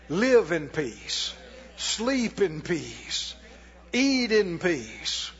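very slow, each phrase drawn out; slow emphasis. Real, not acted. Preacher blessing the congregation, slow and calm. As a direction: lay each phrase down gently and slowly. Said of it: "slow emphatic list".

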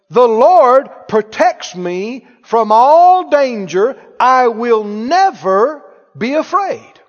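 emphatic preacher's Bible reading; proclaimed scripture. Real, not acted. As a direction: ring out each phrase with conviction. Repeating scripture forcefully to congregation.

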